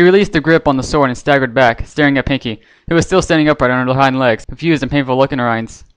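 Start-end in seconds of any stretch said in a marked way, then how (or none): none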